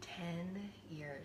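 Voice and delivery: hushed echo; whisper. Real, not acted. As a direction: whisper it back in awe. Guest echoes in whisper.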